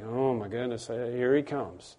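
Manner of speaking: low, soft mock exasperation; weary imitation. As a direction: groan it quietly, pretending to be fed up. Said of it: "God never gets tired and he goes".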